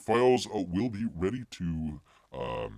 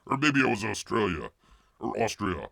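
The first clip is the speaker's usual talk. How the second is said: ordinary talk, nothing marked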